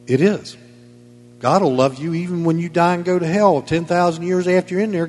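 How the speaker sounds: usual speaking voice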